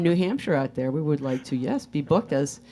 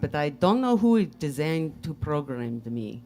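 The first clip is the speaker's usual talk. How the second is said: put-on slow Russian accent in even syllables; program-sounding Russian robot. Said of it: "I am a robot".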